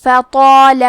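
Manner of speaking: فطال with a heavy ط and a full, heavy long alif; فطال with a thick ط and a drawn-out heavy ا; recited فطال, heavy ط and alif. Shown as a demonstration. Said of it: "مد الف کو بھی بھاری آواز میں پڑھنا ہے".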